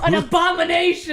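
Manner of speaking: loud, high proclamation; mock outrage. Co-host condemning cat dog, loud mock-outraged cry. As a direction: thunder it out in mock horror.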